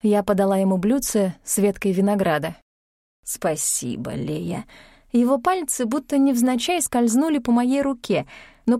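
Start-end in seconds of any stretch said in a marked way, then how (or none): 3.2-4.7 low man's voice. Acted. Emperor thanks her, low voice.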